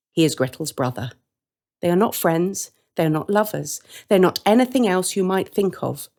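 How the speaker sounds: ordinary delivery, nothing marked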